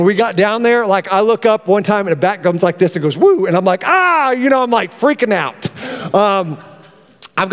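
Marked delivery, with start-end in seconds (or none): 3.2-3.5 high loud swooping "Woo!"; bat whoosh. Retold or imitated. Telling the congregation about the bat, high swooping whoosh. 3.9-4.3 high sudden "Ah!" of fright; startled yelp. Retold or imitated. Retelling the bat scare, high startled yelp.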